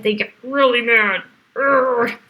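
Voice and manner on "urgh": mock angry growl.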